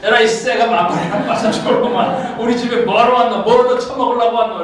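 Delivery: high, laughing mock curse; cursing granny. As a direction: play the foul-mouthed grandma. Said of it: "이러고 욕을 한번 하고 싶어". (웃으며 흉내 낸 욕쟁이 할매 욕)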